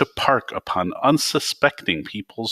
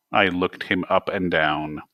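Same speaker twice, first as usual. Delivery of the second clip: ordinary delivery, nothing marked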